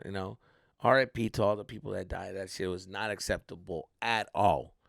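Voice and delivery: normal talking voice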